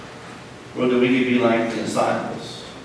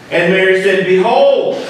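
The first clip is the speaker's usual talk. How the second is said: dramatic scripture reading; 7 semitones higher, slower than usual. Acted. Preacher reads scripture dramatically.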